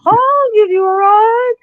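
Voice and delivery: slow, high imitation of a man; high-pitched voice. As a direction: hold it high and drawn out. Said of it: "described that guy with a high-pitched voice".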